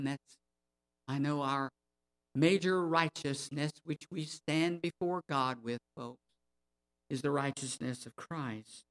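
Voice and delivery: usual speaking voice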